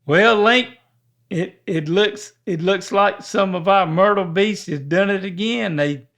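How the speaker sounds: upbeat show host intro; announcer voice. Acted. Announces the word game segment.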